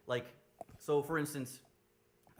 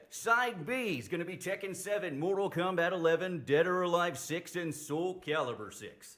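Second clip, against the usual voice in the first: announcer voice; 6 semitones higher, 6 dB louder than usual. Pretend. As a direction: roll the game names out like a TV host. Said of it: "your rich, buttery announcer voice?".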